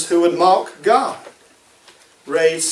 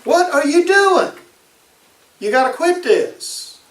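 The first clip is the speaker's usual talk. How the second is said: higher, fed-up husband scolding his sulking wife; a bewildered husband throwing up his hands; exasperated husband; 10 semitones higher than usual. Retold or imitated. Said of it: "he looked at her and he said".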